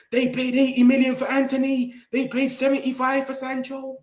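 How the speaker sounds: high, monotone parody of supporters; mocking rival fans. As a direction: whinge it in a mock high voice. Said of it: "the Newcastle fans, they're saying".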